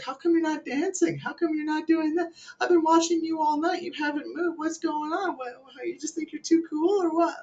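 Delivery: high falsetto pestering woman; mock club girl. Club girl questioning a guy who won't dance, mocking falsetto. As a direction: imitate a nagging girl in exaggerated falsetto. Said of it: "chicks will come up to me, and they'll be like".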